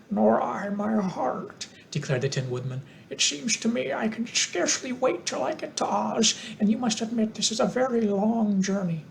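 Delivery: high, metallic-toned Tin Woodman; mild impatient tin man. A narrator's reading.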